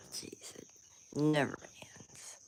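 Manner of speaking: weary breathy whisper; whispered sigh. Real, not acted. Sighing, stressed out.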